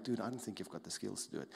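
low, soft and quick put-down; critical inner voice. Acted. Critical voice doubting the listener's skills, low and quick. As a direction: quietly play the inner critic. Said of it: "you've got the critical voice going like".

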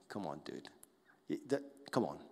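low, soft dismissive mutter; mocking inner voice. Mocking voice in your head, talking down to the discouraged. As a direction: needle them like a mocking inner voice. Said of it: "this mocking voice in your head".

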